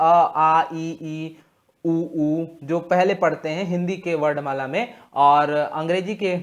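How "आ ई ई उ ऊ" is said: आ, ई, ई, उ, ऊ as separate, drawn-out Hindi vowel sounds; reciting vowels. Shown as a demonstration. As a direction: sound out each vowel like a teacher. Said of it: "तो हम लोग स्वर जो है".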